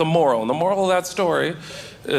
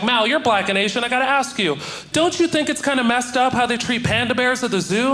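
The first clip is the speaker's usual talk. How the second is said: higher, earnest questioning voice; naive curious girl; 7 semitones higher than usual. Retold or imitated. Girl asking the mixed comic about pandas, higher earnest. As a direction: ask it with total innocence. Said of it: "this girl was like".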